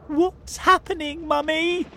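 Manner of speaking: put-on very high, little-child voice; a frightened cub asking mum; small fox child. A narrator's reading. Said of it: "asked one of the small foxes".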